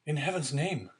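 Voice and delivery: high pleading exclamation; unhappy cry. A narrator's reading. Young prisoner appealing to the deputy, high unhappy cry. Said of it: "cried the unhappy young man".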